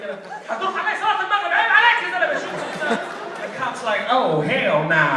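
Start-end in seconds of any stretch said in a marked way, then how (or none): 0.0-3.0 fast, high, loud mock protest; hammed-up rapid-fire complaint; ranting in Arabic. Confusing cop with Arabic rant. 4.1-5.1 slow, loud drawn-out disbelief; caricatured cop balking; dismayed cop. Retold or imitated. Stunned cop reacts to Arabic.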